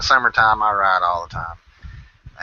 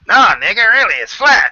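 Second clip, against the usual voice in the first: higher, louder insistence; insistent; 8 semitones higher, 7 dB louder than usual. Retold or imitated. Ed insisting it's flat, louder and higher. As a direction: push the point hard. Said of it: "He said".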